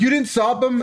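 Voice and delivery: high, outraged yell of disbelief; retold shout. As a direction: shout it up high, can't believe it. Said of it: "I remember shouting at them".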